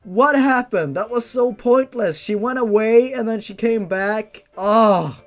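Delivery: exasperated rant. Real, not acted. Reader ranting to the audience about chapter eleven, exasperated shouting. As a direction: rant in disbelief at a pointless chapter.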